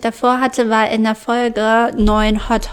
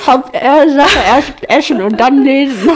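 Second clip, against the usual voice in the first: louder, slow, in a put-on voice; reenacted line; 3 semitones higher, 7 dB louder than usual. Shown as a demonstration. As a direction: act the scene out as it was. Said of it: "ich stell die Szene nach".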